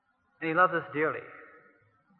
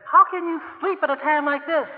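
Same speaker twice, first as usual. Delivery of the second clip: exasperated plea; 8 semitones higher than usual. Pretend. Disciples' plea, higher.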